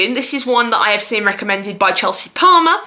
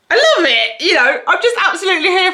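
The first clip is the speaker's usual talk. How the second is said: delighted gush; 8 semitones higher than usual. Real, not acted. Delighted by synopsis.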